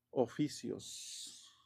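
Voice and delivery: very slow, drawn-out "Oficios" spoken while writing; slow dictation. Shown as a demonstration. Teacher dictating to students while writing, very slow. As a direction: stretch "Oficios" syllable by syllable as you write it. Teacher writes word on board.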